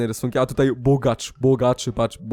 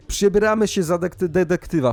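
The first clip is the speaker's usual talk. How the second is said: ordinary talk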